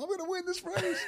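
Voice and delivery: squeaky high, laughing boast; mock your own cockiness through a laugh; laughing brag. Storyteller mocking his younger self, laughing brag.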